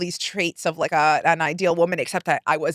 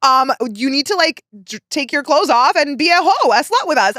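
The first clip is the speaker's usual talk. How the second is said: mocking cousins; 6 semitones higher, 5 dB louder than usual. Cousins mock her outfit.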